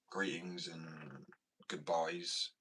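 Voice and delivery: greetings and goodbyes stretched very slowly, each word drawn long; slow drawn-out phrase. Shown as a demonstration. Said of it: "trying out the phrase".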